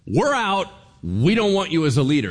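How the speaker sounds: loud, high defiant rejection; angry crowd shout. Retold or imitated. Israel refusing the king, shouting in defiance. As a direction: shout them down like a fed-up nation.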